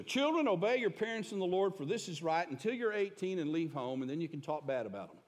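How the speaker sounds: mock-proclaim it as if quoting; mock scripture reading. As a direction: start high and loud, then ease down quickly. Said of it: "Not what it says".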